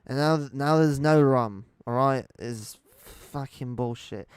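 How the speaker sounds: loud, hammed-up drunken pirate impression; rough British-accented pirate, very loud; Johnny Depp. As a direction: bellow it like an outraged pirate out of rum. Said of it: "I can't do an amazing, uh, Johnny Depp".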